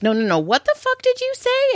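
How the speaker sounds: high-pitched retold outrage; indignant confrontation. Mother imitating Caleb confronting Tyler in the kitchen, indignant and high. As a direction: act out an incensed challenge, voice shooting up.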